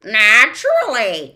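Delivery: loud cackle of a word; cackling. Acted. The bird cackles at Tock, loud. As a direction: laugh it out at them. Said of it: "he cackled".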